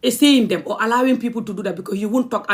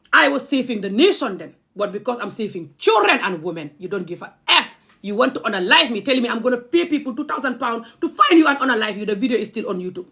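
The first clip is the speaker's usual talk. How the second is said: ordinary talk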